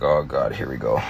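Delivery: very low, sinking aside; retold weary inner groan; resigned dread. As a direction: mutter it low, bracing for embarrassment. Father's dread at teacher's question.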